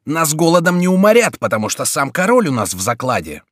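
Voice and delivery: high, loud start settling into resolve; bold, resolute. A narrator's reading. Mayenne with the king as hostage, bold and resolute. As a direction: declare it boldly and decisively. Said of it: "смело и решительно вскричал Майен".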